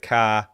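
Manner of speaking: ka with dropped R, broad open ah; Boston ka. Shown as a demonstration. Showing off his Boston accent on ka. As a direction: say ka Boston style with no R. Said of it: "You hear this a lot when I say".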